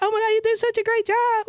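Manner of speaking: high-pitched mimicked gushing; imitating excited praise. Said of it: "She's just like".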